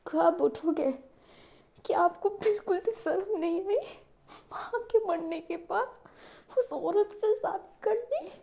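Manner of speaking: high-pitched, breaking reproach to father; tearful reproach. A narrator's reading. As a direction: cry out the hurt at him. Daughter rails at sleeping father. (रोते हुए पिता को ताना)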